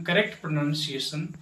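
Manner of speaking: ordinary talk, nothing marked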